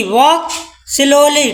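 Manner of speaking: drawn-out slowly. Shown as a demonstration. Teacher drawing out the words for her students, very slow. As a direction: stretch every sound of slowly way out. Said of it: "slowly मतलब आराम से".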